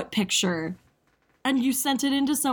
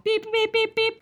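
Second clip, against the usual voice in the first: beeping noises; 10 semitones higher than usual. Pretend. Sound of incoming transmission.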